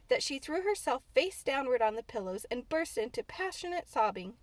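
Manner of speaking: usual speaking voice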